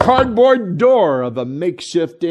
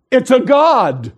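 put-on slow, high, louder kid proclamation with a laugh; proud announcement; 6 semitones higher, 3 dB louder, slower than usual. Little doctor announcing the baby to the crowd, slow proud. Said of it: "He comes out and he goes".

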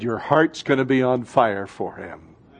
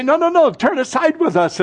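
much higher, eager pleading; urgent plea; 12 semitones higher than usual. Acted. Disciples urging Jesus to stay, pleading much higher. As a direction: beg him to stay. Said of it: "they say".

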